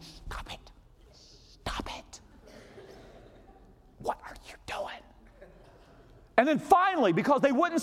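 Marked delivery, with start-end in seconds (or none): 0.0-5.0 sharp unvoiced whisper from the front row; urgent whisper. Pastor in the front row hissing at the singing team, urgent whisper. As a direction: hiss it at them so the congregation can't hear. Trying to get singers' attention.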